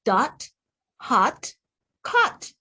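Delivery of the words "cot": usual speaking voice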